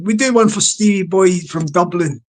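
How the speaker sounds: normal talking voice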